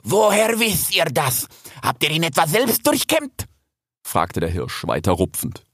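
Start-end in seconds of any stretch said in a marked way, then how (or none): 0.0-3.5 high-voiced stag, accusing; aggressive stag, challenging. A narrator's reading. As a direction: question him like a hostile interrogator. Raging stag challenges Rix.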